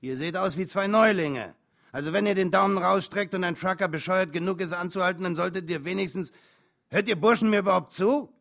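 high, very loud trucker tirade; furious dressing-down, rising at the end; angry shout. A narrator's reading. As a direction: yell at two clueless greenhorns. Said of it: "und schrie".

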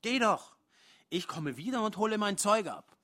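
loud, high yell; shouting. Acted. Angry partner Joan yells at him to leave, loud and high.

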